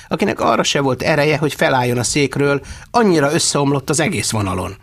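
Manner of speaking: plain reading voice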